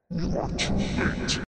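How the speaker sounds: slow rasping demon whisper; breathy, unvoiced demon hiss; oni. A narrator's reading. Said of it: "came the Oni Lord's voice".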